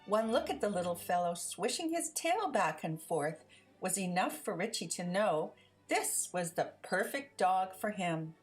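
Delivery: plain reading voice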